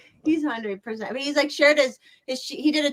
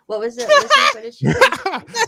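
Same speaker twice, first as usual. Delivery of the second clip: excited; 12 semitones higher, 7 dB louder than usual. Real, not acted. Eager excited questions, laughing.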